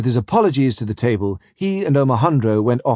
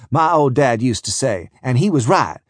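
usual speaking voice

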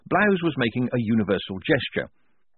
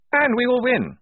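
high, loud hearty officer voice; blustering major; 5 dB louder than usual. Acted. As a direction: boom out like a jolly old officer.

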